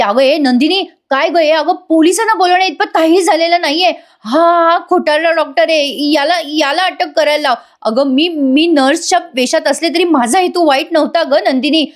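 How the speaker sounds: wriggle out by blaming the doctor; panicky denial. Retold or imitated. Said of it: "Priya म्हणते". (उंच, घाबरलेला कांगावा)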